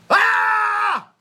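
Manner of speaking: high full-throated battle cry "Ah!"; shout of victory. Shown as a demonstration. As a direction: let out a triumphant war cry. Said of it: "ang shout of victory nila is". (malakas na sigaw ng tagumpay)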